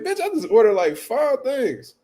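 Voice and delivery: high, then drawn out slowly; indignant comeback to the lady; high incredulous complaint; exasperated retort. Retold or imitated. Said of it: "I'm like".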